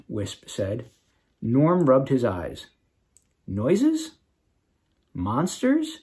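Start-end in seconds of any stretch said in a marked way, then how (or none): 3.4-6.0 puzzled. A narrator's reading. Sleepy Norm confused.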